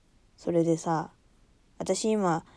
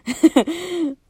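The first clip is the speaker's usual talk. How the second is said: loud sudden laugh; burst of laughter; 9 semitones higher, 7 dB louder than usual. Real, not acted. Laughing at her own abrupt topic change.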